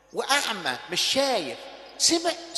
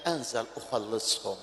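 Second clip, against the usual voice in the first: hushed divine reply; 12 semitones lower, 9 dB quieter than usual. Acted. God's reply voiced softly.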